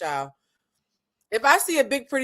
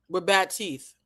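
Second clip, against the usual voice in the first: ordinary talk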